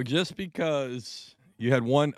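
ordinary talk, nothing marked

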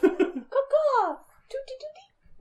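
high laughing bird call; chirping bird call. Acted. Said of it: "I thought I heard a bird".

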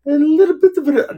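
put-on high, playful English accent. Joking about picking up an English accent, mock English voice. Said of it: "developing a bit of an English accent".

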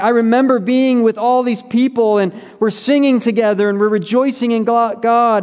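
happy reminiscing. Acted. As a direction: recount shared joy, pitch lifted throughout. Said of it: "he's remembering happy times".

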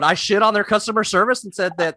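usual speaking voice

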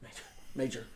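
very soft, low urgent call; hushed call. Retold or imitated. Dalton discreetly calling Major over, hushed. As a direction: get a friend's attention under your breath.

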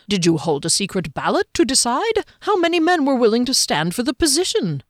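a hint of a keen little halfling asking eagerly.

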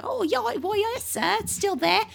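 put-on very high eager boy voice; turkey boy. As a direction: imitate an over-keen little street boy. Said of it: "This is what he sounds like".

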